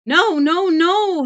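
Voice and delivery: high, loud panicked screaming; frenzied scream. Acted. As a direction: scream in terror. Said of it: "Heck screamed in frenzied counterpoint".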